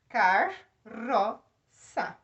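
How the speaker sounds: car-ro-ça said slowly, the R R split between syllables. Shown as a demonstration. Teacher showing students where carroça splits, slow syllables. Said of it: "separo um R pra cá, um R pra lá".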